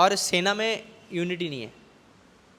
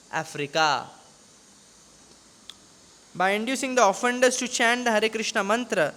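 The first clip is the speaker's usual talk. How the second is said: slow, deliberate scripture reading; measured recital of written commentary; formal reading aloud; 3 semitones higher, much slower than usual. Acted. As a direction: read it out evenly, stretching each phrase.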